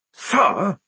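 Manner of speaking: high, strained hissed protest; furious hiss. A narrator's reading. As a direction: hiss with suppressed rage. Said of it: "hissed Jackram".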